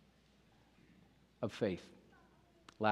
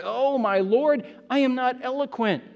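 loud protest; 10 semitones higher, 7 dB louder than usual. Acted. Moses pleading with the Lord, loud protest. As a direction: cry it out high and loud.

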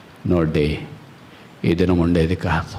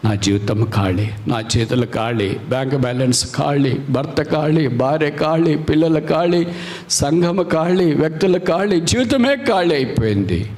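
rising lament; 6 semitones higher than usual. Acted. Preacher voicing a believer crying out that life is empty, rising. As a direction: pile up each loss. Listing a growing emptiness.

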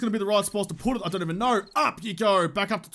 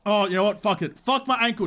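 ordinary talk, nothing marked

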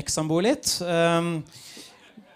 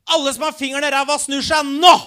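higher, louder call across the bus; shouted out; 7 semitones higher, 9 dB louder than usual. Retold or imitated. Fourteen-year-old on the back row calling out to the packed bus, shouting. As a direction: yell it to the whole bus from the back. Roper ut i bussen.

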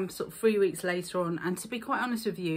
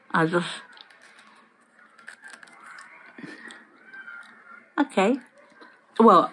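strips over the teeth; whitening strips on teeth; 4 dB louder than usual. Real, not acted. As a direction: lips held off teeth. Seen 0: Presses strip onto upper teeth at +0.5; lips hide it later.

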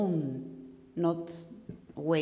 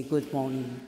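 good morning sunk low; low-toned greeting; 4 semitones lower than usual. Shown as a demonstration. Coach demonstrates the tone to avoid, low. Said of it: "I have this low tone".

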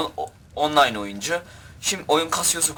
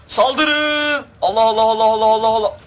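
high, loud charging war cry; rally the troops into the attack, shouting; hammed-up battle yell; 9 semitones higher, 6 dB louder than usual. Leading soldiers into a charge.

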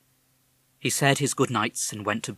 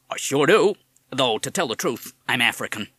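put-on higher, louder parrot with a tough American accent; 4 semitones higher, 7 dB louder than usual. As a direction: talk like a fast-talking American wise guy. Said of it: "You have an American accent".

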